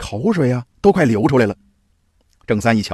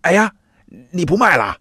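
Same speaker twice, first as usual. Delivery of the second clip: higher-pitched, alarmed question; startled exclamation; 5 semitones higher than usual. A narrator's reading. Old man learns the seller may quit, startled. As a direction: cry out in surprise. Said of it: "鬼老七惊叫道".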